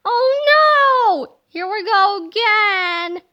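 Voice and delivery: high, loud cry of dismay; comic panic. Acted. The boys cry out as trouble strikes again, loud and high. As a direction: wail like a cartoon kid caught again.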